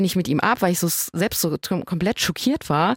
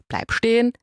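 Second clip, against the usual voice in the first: mock call after him; 5 semitones higher than usual. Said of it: "Ich ihm hinterhergerufen".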